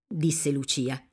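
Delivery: plain reading voice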